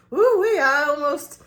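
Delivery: high, loud whooping exclamation; restaurant worker's whoop. Retold or imitated. Restaurant worker to customers after a near drop, whooping. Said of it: "I'll try to do it as best as I can".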